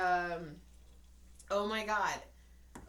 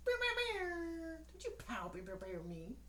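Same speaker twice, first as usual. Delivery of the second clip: mock scolding; 10 semitones higher, much slower than usual.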